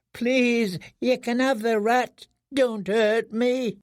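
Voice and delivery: high, slow pleading don't hurt me; begging prisoner. Acted. Prisoner begging the boys not to hurt him, high slow plea. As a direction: offer the rat to be spared.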